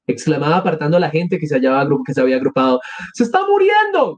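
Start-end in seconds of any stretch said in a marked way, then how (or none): 3.1-4.1 very high cry of alarm; acted shrieked alarm; bystander's shout. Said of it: "gritó alguien".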